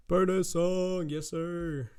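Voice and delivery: high, drawn-out cheer; playful hype. Real, not acted. Reviewer hyping up the bonus song, playful drawn-out cheer. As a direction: stretch it out in a playful high call.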